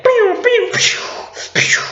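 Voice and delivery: high mock "pew" blaster shots; blaster noises. Stormtroopers firing on the cantina, high mock blaster sounds. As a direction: imitate laser guns firing in a high voice. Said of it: "they just like".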